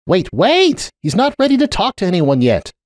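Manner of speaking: high, pressing call to stop her; urgent plea. A narrator's reading. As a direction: rush it out to hold her back. Blocking Cupcake at the door.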